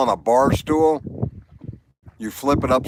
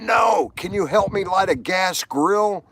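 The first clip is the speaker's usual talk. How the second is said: higher shouted answer; shouted reply; 7 semitones higher than usual. Retold or imitated. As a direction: yell back over the wind. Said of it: "And he went".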